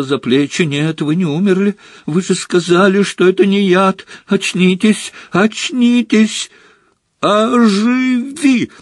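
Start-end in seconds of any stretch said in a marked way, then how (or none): 0.6-6.5 high, mounting desperation; desperate pleading. A narrator's reading. Harry shaking Dumbledore to wake him, desperate pleading. 7.2-8.7 high, long-drawn desperate cry; cried-out spell. A narrator's reading. Harry pointing his wand at Dumbledore, cried-out spell. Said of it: "выкрикнул он".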